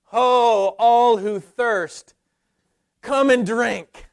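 loud, slow, high prophetic call; proclaiming call. Acted. Preacher calling the thirsty to come and drink, loud proclamation.

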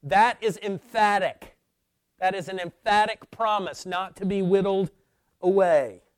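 loud, high, emphatic pulpit delivery; insistent preacher's declaration; forceful preaching. Real, not acted. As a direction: drive each key word home to the congregation.

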